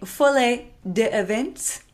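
slow, careful attempt at the French "Folie d'Events"; "Folie d'Events" drawn out in careful French; tentative French pronunciation. Shown as a demonstration. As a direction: sound out "Folie d'Events" slowly as an unsure non-native. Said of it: "you say it much better than me".

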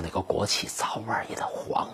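hushed, voiceless whisper of a gossip; mock whisper. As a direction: whisper it like a rumour being spread. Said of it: "好多公知天天说".

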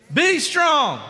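high, loud pulpit cry; proclaimed command. Acted. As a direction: call it out as a rousing command. Proclaims the repeated command from scripture.

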